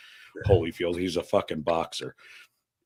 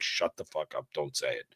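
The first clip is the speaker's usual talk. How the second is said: hushed warning; 6 semitones lower, 9 dB quieter than usual. Retold or imitated. Mom's silent warning voiced.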